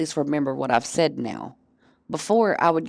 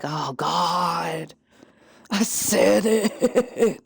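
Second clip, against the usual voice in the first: dawning horror; much slower than usual. Retold or imitated. Realizing what she said.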